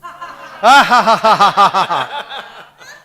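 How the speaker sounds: loud, high, hammed-up fake laughter. Shown as a demonstration. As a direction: belt out a big deliberate fake laugh to show how it's done. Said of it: "Do you know how to fake laugh?".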